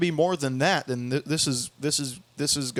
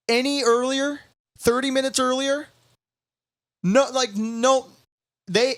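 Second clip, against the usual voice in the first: incredulous rant; 10 semitones higher than usual. Real, not acted. Sarcastic rant about late cancellation.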